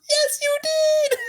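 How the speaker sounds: very high, drawn-out mock squeal; imitated laughing skipper. Laughing skipper answers the guilty rider, imitated high squeal. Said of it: "and he's laughing".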